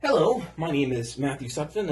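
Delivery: usual speaking voice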